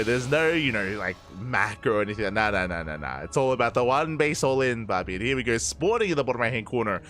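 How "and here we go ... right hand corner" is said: energetic esports caster; live play-by-play. Acted. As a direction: call the match like a live broadcast. Live casting of the game.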